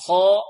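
خاء as a long, full, dark "khaa"; heavy held syllable خاء. Shown as a demonstration. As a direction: model a loud emphatic خاء. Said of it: "حروف الاستعلاء سبعة".

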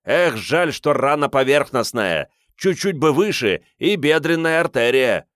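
high, loud excited shout. A narrator's reading. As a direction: keep yelling over the roar. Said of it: "прокричал в самое ухо Риордану меркиец".

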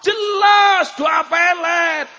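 high, loud drawn-out shouted answer; acted cry of total certainty; emphatic shout. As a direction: shout it out, stretching the words. Acted man's emphatic triumphant answer.

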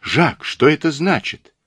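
high, mildly alarmed question; breathless cry. Acted. Mother seeing her son under arrest, crying out. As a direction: demand an answer in rising alarm. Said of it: "задыхаясь, прокричала она".

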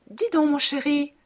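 high, sweet fairy-like wife. Acted. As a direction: coo it like a little fairy. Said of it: "d'une voix de fée".

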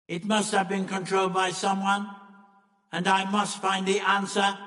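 level mechanical accusation; stern Monoid leader. Acted. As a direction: state it like an alien machine.

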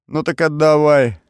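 loud, high defiant retort; defiant dare. A narrator's reading. Prisoner defiantly dares his interrogator. (дерзкий вызов, громко)

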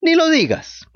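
high, loud and trembling puppet; frightened cry. A narrator's reading. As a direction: cry out, still shaking with fear. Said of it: "gritó Pinocho temblando todavía de miedo".

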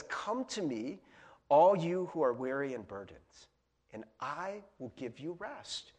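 soft, unhurried invitation to the weary; tender scripture quote, softly spoken; gentle invitation. Acted. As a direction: offer it quietly, slowing into the last words. Jesus's invitation, set against demanding version.

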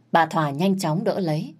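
usual speaking voice

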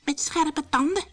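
high frightened addition; alarmed call. A narrator's reading. Pietje pictures the dogs' teeth, high alarm. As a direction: add it in rising dread. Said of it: "riep Pietje".

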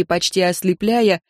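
plain reading voice

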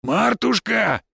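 high, drawn-out call to another room. A narrator's reading. Husband shouts to his wife in the house, calling out. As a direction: holler slowly for someone indoors. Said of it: "громко прокричал Финн".

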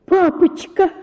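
high terrified scream of papa; a girl crying out in horror; высокий крик ужаса. Acted. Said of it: "закричала я в полном ужасе".

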